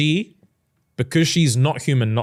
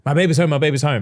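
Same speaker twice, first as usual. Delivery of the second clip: high, fast and louder, crazy with joy; hammed-up besotted lover; giddy insistence; 6 semitones higher, 5 dB louder, faster than usual. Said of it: "He's crazy".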